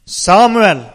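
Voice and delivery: loud, ringing call of a name. Acted. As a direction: call the boy's name out loudly. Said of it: "Da ropte Herren enda en gang". (høyt, kallende rop)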